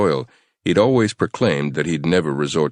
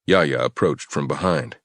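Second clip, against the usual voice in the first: usual speaking voice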